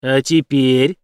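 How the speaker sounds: ordinary delivery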